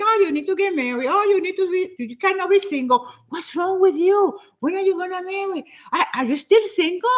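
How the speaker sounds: high-pitched mimicry of nosy people; judgmental nagging. Mocking people who push singles to marry, nagging. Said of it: "they do it with this kind of intonation".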